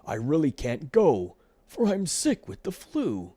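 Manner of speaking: high, loud whining flea; fake-sick complaining; whiny Fred. A narrator's reading. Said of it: "Bad Fred saw his chance".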